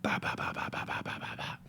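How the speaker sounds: rapid string of voiceless bahs; imitated bah-bah noise. Imitating wife coming home, rattled-off bah noises. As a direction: mimic a repeated noise, softly and percussively. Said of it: "she started going".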